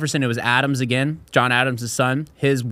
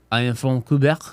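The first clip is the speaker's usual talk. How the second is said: put-on French Canadian accent. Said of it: "there was Canadians on the baseball team".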